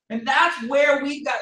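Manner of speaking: ordinary talk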